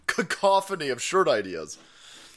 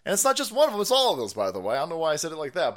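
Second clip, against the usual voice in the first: ordinary delivery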